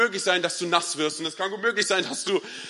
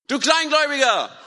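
high, loud shout over a storm; 9 semitones higher, 6 dB louder than usual. Acted. Jesus calling to Petrus in the storm, shouting. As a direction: yell it across wind and waves. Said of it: "Er muss geschrien haben".

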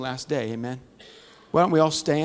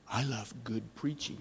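much softer, hushed confession; like a secret; 5 semitones lower, 15 dB quieter than usual. Retold or imitated. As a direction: say it like a secret nobody may hear. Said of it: "It was like a secret".

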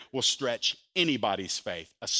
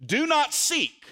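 slow, higher, stressed command; firm command; 10 semitones higher, slower than usual. Acted. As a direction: lay down the command slowly, like a drill sergeant. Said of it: "here's the command from Jesus".

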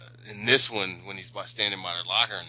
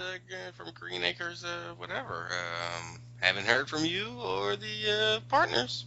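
voicemail voice; 9 semitones higher than usual. Retold or imitated. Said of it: "they leave a message".

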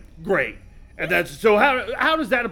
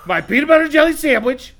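put-on high, loud childish demand; whiny tantrum; 7 semitones higher, 7 dB louder than usual. Captain whining to the husband about his snacks, high and loud. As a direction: stamp your feet like a spoiled kid.

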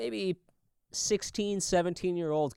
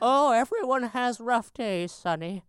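lightly voiced old lady; 5 semitones higher than usual. Voicing the kind old lady.

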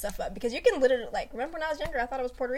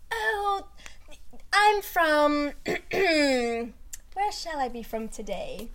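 higher, very slow, a lightly acted worldly poser; mock grand stranger; 3 semitones higher, much slower than usual. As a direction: stretch out the made-up origin as if deciding. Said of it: "I'm making up some shit from now on".

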